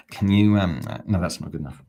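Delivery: usual speaking voice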